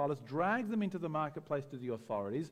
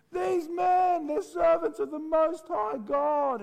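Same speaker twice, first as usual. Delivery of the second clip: high, loud crying out of a proclamation; loud, high proclamation yelled at passers-by; shouting girl; 12 semitones higher, 7 dB louder, slower than usual. Retold or imitated. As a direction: shout it after them down the street. Girl shouting after Paul.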